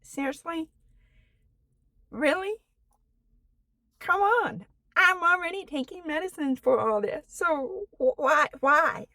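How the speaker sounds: a mild, understated fed-up outburst; frustrated. Retold or imitated. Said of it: "it's frustrated".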